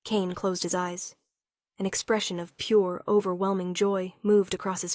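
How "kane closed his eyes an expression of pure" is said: usual speaking voice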